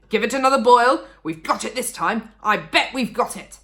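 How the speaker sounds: loud, high, confident crowing; eager Mister Cranky. A narrator's reading. Mister Cranky sure of the new batch, eager crowing. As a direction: crow that it'll work.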